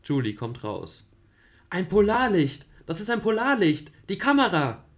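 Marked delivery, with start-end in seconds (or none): 1.7-4.8 much higher and loud; thrilled exclamation. Acted. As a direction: shout with wonder. Julie sees aurora. (begeisterter Ausruf, viel höher)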